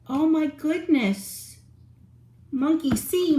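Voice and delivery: ordinary delivery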